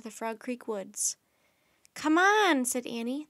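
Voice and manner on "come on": high, loud, excited call; eager urging. Acted. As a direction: urge a friend to follow. Annie urges Jack to follow.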